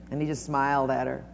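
usual speaking voice

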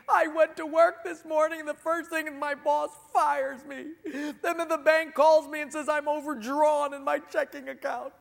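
high, loud blubbering wail, half laughing; hammed-up crying. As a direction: sob through the story, laughing at your own joke. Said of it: "this guy starts crying profusely".

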